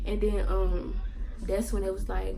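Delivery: usual speaking voice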